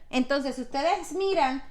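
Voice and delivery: ordinary delivery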